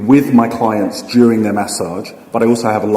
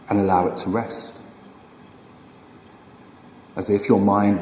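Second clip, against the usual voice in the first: slow, unhurried meditation guide with long pauses; guided meditation. Acted. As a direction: ease them into stillness, phrase by phrase, leaving room to breathe.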